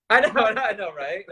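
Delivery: loud, high burst through laughter; laughing agreement. Real, not acted. Co-host jokes about buying one book, laughing agreement. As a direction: agree loudly while laughing, pitch jumping up.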